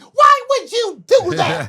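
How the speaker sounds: high, slow loud shriek; shrieking teacher. Retold or imitated. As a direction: wail it like a shocked lady. Teacher scolding after prank.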